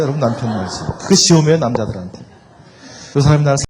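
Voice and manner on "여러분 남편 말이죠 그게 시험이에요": usual speaking voice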